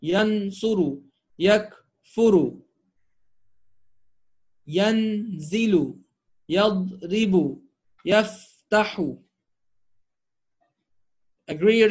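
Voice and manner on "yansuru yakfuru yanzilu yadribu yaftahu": slow careful model pronunciation. Shown as a demonstration. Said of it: "teacher models verb forms".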